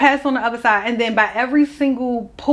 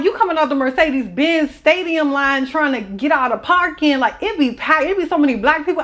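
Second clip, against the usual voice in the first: ordinary delivery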